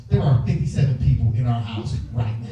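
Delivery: normal talking voice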